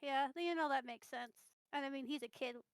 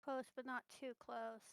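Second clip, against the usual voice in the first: ordinary delivery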